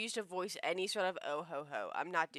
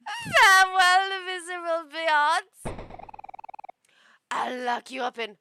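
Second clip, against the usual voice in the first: loud, grandiose mock German accent; villainous German accent; 7 semitones higher, 15 dB louder, slower than usual. Princess banishing the hero, loud mock German accent. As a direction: declaim the threat in a hammy German accent. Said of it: "Just say T Hs as Zs".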